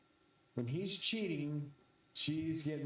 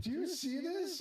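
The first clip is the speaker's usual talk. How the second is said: high, incredulous question; mock-amazed, voice pitched way up; retold astonishment; 14 semitones higher than usual. Retelling astonished question to nephew.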